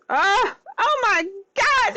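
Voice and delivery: high excited squeal; gushing high exclamation; thrilled. Real, not acted. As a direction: squeal it with delight. Thrilled at how shirt looks.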